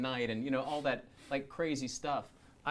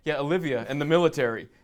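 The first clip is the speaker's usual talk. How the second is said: mocking himself; 4 semitones higher, 14 dB louder than usual. Mocking his own weak answer.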